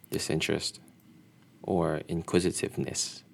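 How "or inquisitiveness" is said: usual speaking voice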